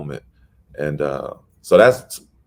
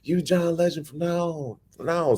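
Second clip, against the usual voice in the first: high, hyped declaration; excited crowd; 11 semitones higher than usual. Retold or imitated. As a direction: crown him with the name. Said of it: "They was like".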